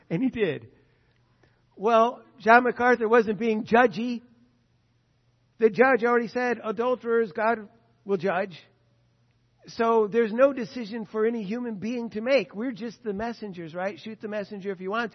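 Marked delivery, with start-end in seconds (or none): 1.8-12.5 loud, driving sermon delivery; forceful preaching. Acted. Preacher drives home his point, loud and forceful. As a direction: preach it hard to the room.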